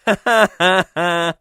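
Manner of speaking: loud staccato ha-ha-ha, then a sighed ha; mocking laugh. Reviewer laughing at the studio's worry, loud staccato cackle. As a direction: laugh it out in separate bursts, then let it tail off.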